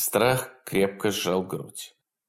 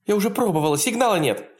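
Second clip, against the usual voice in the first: panicked; 11 semitones higher, much faster than usual. A narrator's reading. Panicked woman answers.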